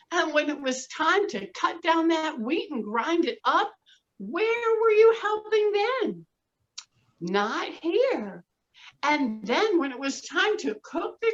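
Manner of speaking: high, scolding mother hen; indignant hen. Acted. Hen scolding the lazy animals, high and indignant. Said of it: "And the little red hen said".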